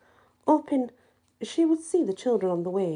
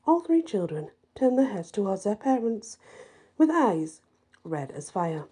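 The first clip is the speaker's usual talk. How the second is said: ordinary delivery, nothing marked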